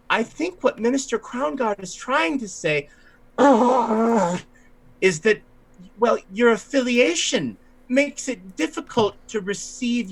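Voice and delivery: high-pitched, halting politeness; high, hesitant conciliatory character voice; fussy ailing minister. Acted. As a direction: pick through the words delicately, voice way up. Stuffy-nosed minister gently intervenes.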